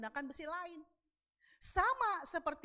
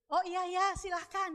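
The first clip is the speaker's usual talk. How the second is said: higher, louder, eagerly agreeable mock reply; imagined obliging reply; 6 semitones higher, 5 dB louder than usual. Imagined stranger giving up the seat, eagerly obliging. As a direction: play the person who happily gives way. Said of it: "orang normal gimana?".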